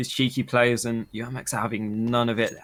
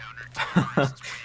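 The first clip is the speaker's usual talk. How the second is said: breathy laugh. Real, not acted. Laughing after the big shot.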